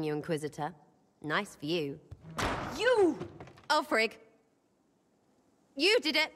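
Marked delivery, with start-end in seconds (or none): 2.8-6.3 loud gleeful yelling; excited shout. Acted. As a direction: shout with delighted disbelief.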